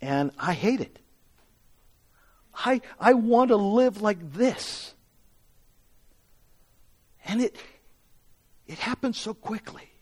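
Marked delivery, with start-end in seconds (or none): none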